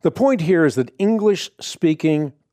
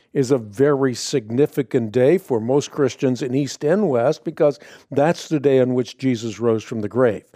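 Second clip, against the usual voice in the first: ordinary talk, nothing marked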